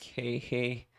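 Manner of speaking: ordinary talk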